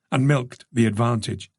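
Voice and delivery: plain reading voice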